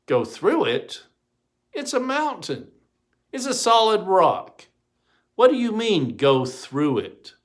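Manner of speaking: high, disbelieving objection; can't believe what he's asked; incredulous protest. Acted. Mayor protesting to the voice, incredulous.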